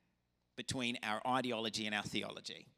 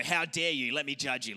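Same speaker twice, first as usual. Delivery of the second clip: mock harsh judging; 5 dB louder than usual. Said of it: "come at them hard and go".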